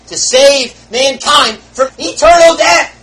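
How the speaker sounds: loud, high and slow proclamation; preaching shout. Acted. Preacher proclaiming salvation to the congregation, loud and slow. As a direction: drive it home slowly.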